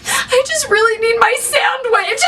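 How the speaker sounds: imitating bawling. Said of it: "and she is bawling".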